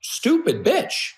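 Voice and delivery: slow, high questioning repeat; incredulous echo. Retold or imitated. Friend repeating his insult back to him, incredulous. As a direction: draw it out high in disbelief.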